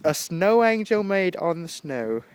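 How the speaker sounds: ordinary talk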